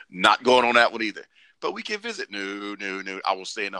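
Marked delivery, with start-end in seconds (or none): 1.6-2.3 high-pitched imitated pleader; mock coaxing. Someone pleads with reluctant traveler, mock coaxing. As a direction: coax in a put-on high voice.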